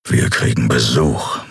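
big creature hearing danger outside; growling Keru. A narrator's reading. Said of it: "knurrte er".

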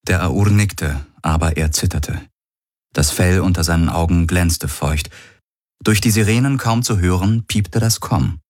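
ordinary delivery, nothing marked

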